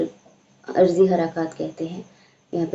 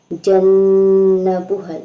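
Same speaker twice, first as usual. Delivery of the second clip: slow, strong chanted recitation, louder; loud Quran recitation; 7 dB louder, much slower than usual. Shown as a demonstration. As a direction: project the verse in a full recitation voice. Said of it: "teacher recites the word".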